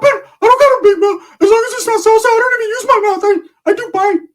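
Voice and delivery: very high, loud put-on squeal; squeaky Ricky voice. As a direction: squeal it like an indignant little guy. Ricky voiced, defending himself.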